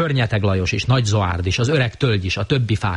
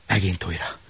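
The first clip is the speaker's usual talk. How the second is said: hushed; 5 dB quieter than usual. A narrator's reading. Varied refrain, quiet.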